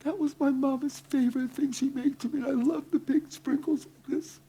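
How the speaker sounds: very high, soft tearful voice; imitated crying. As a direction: act out her crying words high and thin. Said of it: "She's crying".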